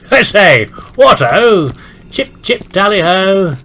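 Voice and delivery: high, loud caricature of British toff talk; mock old-fashioned British exclamations; manly British accent. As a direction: call it out in hammed-up British. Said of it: "my manly British accent".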